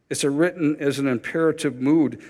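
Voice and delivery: ordinary talk, nothing marked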